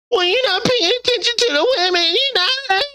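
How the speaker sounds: high, whiny mocking complaint; mock whiny woman. Man mocking women's complaints in a high whiny voice.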